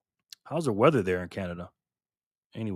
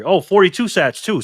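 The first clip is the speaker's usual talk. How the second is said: higher and louder, thrilled at the donation; excited; 7 semitones higher, 7 dB louder than usual. Real, not acted. Streamer thrilled by a viewer's donation, excited. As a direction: call the number out with delight.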